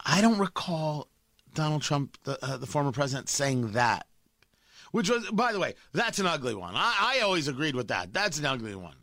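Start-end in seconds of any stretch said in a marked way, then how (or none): 0.1-4.1 lightly voiced evasive denial, sinking lower; a hint of a guilty man dodging the question; mocking impression of Jonathan Karl. Host mocks a reporter who stole the cookie, in a lightly evasive voice. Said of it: "That's what he sounds like".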